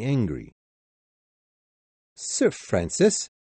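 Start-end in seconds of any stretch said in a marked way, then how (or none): none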